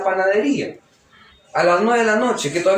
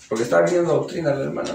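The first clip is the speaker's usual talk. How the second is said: normal talking voice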